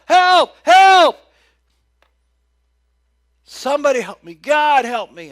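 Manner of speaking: very loud, high screams for help; man hanging from a limb shouting for help; desperate shout. Pretend. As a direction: yell from the cliff edge. Man hanging over cliff.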